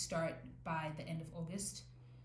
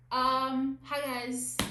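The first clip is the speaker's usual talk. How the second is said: louder, higher bright greeting; host greeting; 6 semitones higher, 8 dB louder, much slower than usual. Shown as a demonstration. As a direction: burst in like an excited host. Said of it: "Oh, I'm so frustrated".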